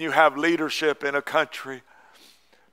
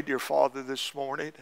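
a hushed pastor's prayer after the sermon; closing prayer. Acted.

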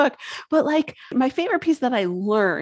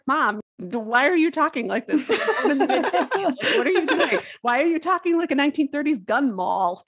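exasperated; 5 semitones higher than usual. Retold or imitated. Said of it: "it's like".

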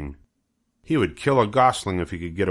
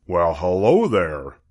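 farmer Zuckerman; 3 dB louder, slower than usual. Acted. Mister Zuckerman greets goslings.

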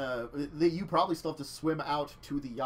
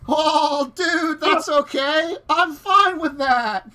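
surfer dude voice; 10 semitones higher, 11 dB louder, much slower than usual. Acted. GM voices surfer yacht owner.

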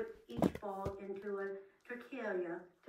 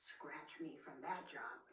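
slow, soft whisper of a lazy bobcat; 7 semitones lower, 9 dB quieter, slower than usual. Acted. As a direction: hush it out, slowly, as if avoiding work. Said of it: "said the bobcat".

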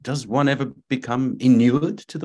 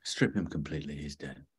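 low, hushed doctor giving orders; doctor ordering the body stripped, low and hushed; doctor's low voice; 9 semitones lower, 12 dB quieter, faster than usual. A narrator's reading. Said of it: "It was Doctor Bashir's voice".

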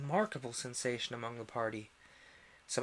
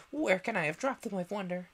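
higher, fretful wondering; anxious rabbit; 6 semitones higher, 4 dB louder than usual. A narrator's reading. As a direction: fret aloud. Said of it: "muttering to itself".

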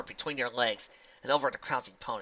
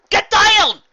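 very high, loud warning shout; 22 dB louder than usual. Acted. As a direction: yell for everyone to duck. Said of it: "Applejack called".

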